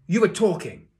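high, very loud accusation; barked. A narrator's reading. Teacher accusing pupil of talking, barked. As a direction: bark it like a sergeant. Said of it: "he barked".

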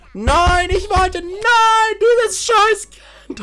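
very high, loud shrieking no, then a whisper; high shriek fading to whisper; screaming. Real, not acted. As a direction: shriek in despair at the screen. Screaming at game character.

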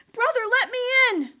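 high, loud, fast plea; panicked pig. Acted. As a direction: beg in a rush. Pig flees to brother.

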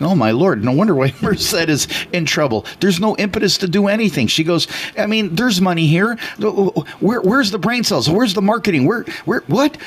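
mock-exasperated friend wondering aloud; exasperated disbelief. Friend's exasperated inner thoughts retold.

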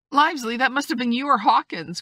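high, loud exclamation; accusing cry. A narrator's reading. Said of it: "cried the squire".

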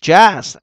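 "Just" punched loud and high with an open uh; mũ vowel. Shown as a demonstration. Teacher modelling the option for students, loud mũ vowel. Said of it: "âm ớ".

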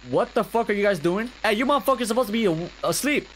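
high angry yell. Real, not acted. Yelling at kids awake.

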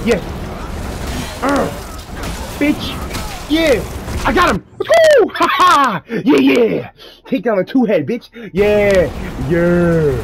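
high, loud victory shrieks; shrieking jackpot winner. Real, not acted. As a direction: scream with triumph. Celebrating a win while gaming.

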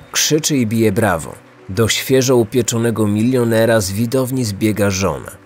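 usual speaking voice

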